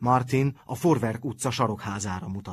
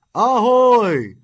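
huge, much higher yell out the window; bellowed; 13 semitones higher, 13 dB louder than usual. A narrator's reading. Égerland signals his gang from the window, bellowing. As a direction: call the gang from the window at full voice. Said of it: "kiordította az udvarra".

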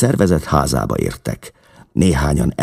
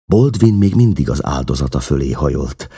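plain reading voice

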